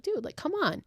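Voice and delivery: high-pitched exasperated yelp; yelling. Retold or imitated. Boy scolds his friend for laughing at his sister. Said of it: "And Marty yells at Brady".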